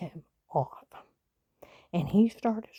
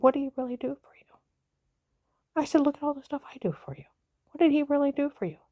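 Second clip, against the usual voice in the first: raised higher and faster, indignant; retelling the fight to the grandson, voice raised and fast; heated retelling; 5 semitones higher, faster than usual. Retold or imitated. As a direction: fire the questions back at him, worked up. Retold heated argument with grandson.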